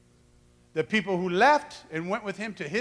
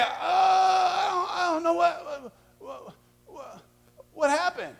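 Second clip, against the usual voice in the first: high, cracking, slow stammer; flustered stammer; 9 semitones higher than usual. Acted. Husband cornered by angry wife, high flustered stammer. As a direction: fumble for words like a cornered spouse. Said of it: "You're like".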